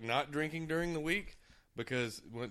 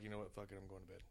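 tired; 4 semitones lower, 14 dB quieter, faster than usual. Retold or imitated. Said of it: "was like".

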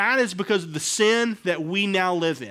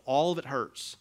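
ordinary talk

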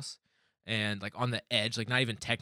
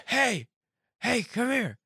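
high, loud mock holler; yelling; 13 semitones higher, 5 dB louder, slower than usual. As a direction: imitate someone insistently calling out. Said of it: "He just kept yelling".

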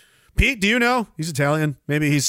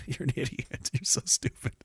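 much softer, under-the-breath jab; muttered insult; 6 semitones lower, 12 dB quieter than usual. Retold or imitated. Telling off foolish activists, muttered under the breath. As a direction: mutter it aside. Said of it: "I'm just like".

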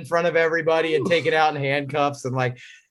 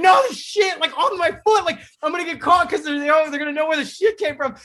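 high, fast panicked rant; mock panic; 8 semitones higher than usual. Retelling his own panic at school, high and rushed. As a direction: panic that you'll get caught, pitched up and rushed.

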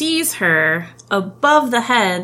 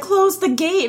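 mock duke shout; 10 semitones higher than usual. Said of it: "the Duke's like".

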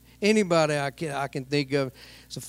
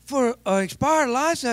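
shocked disbelief; 8 semitones higher than usual. Retold or imitated. Preacher repeating the fine to the officer, high and disbelieving. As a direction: stretch "A thousand dollars" high in disbelief. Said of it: "Like that, I said".

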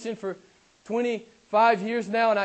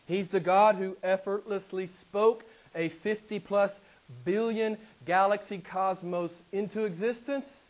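ordinary delivery

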